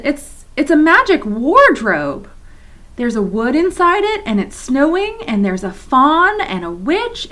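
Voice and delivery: eager telling. Acted. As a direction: share the wonder breathlessly. Lucy eagerly describes Narnia.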